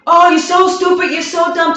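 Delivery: high, loud yelled insult; shouting insult. Shown as a demonstration. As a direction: yell it at your partner, high and loud. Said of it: "the person is constantly shouting".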